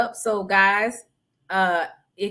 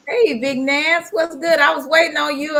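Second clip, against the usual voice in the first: excited greeting; 7 semitones higher, 4 dB louder than usual. Real, not acted. Greeting a friend joining live.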